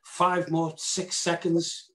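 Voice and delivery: ordinary delivery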